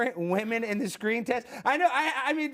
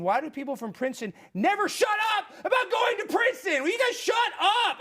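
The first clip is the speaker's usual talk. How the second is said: mock-outraged shout, higher; exasperated shout; 8 semitones higher than usual. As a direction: yell it in fake outrage. Mock rant at Princeton grads.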